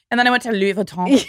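mock the put-on French pronunciation; affected French accent. Shown as a demonstration. Friend mocking people who switch accent mid-sentence, affected French accent. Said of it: "When people change their accent".

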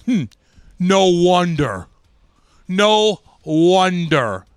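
slow, loud, drawn-out scornful no wonder; mocking coach. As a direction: stretch it out like a coach sneering at a player. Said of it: "Rick Wamsley looks at me and goes".

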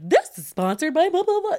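high, rising sing-song recital; mock sponsor disclosure. Host griping about having to disclose ads, mock recital. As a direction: rattle off a disclosure, pitch climbing. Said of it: "sit here and be like".

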